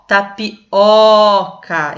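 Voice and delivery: TAPIOCA slowly split, with a loud long open O; ta, pi, then a big open Ó, ca in tapioca; stressed open O. Shown as a demonstration. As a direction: swell hard on the O of tapioca. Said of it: "Eu abro a boca".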